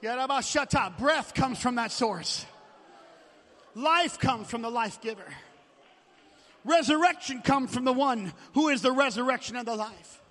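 loud, earnest prayer to God; fervent prayer. Acted. Pastor praying aloud before the congregation, fervent prayer.

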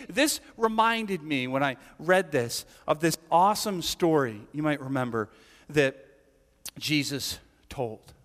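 ordinary delivery

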